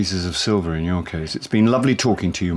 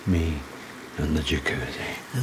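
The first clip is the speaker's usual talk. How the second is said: slow, breath-only whisper; seductive murmur; 4 semitones lower, 7 dB quieter than usual. Acted. Husband alone with wife and jacuzzi, seductive whisper. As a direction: let it drop low into a slow whisper.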